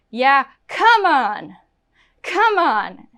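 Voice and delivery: upset, drawn-out "come on"; kind of angry. Shown as a demonstration. Teacher demos the wrong intonation, annoyed and upset. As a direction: protest with exasperation, stretching the first one. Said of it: "now I'm kind of angry".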